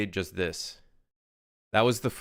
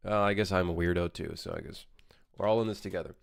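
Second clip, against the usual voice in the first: normal talking voice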